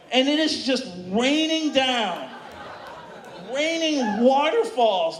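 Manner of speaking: ordinary talk, nothing marked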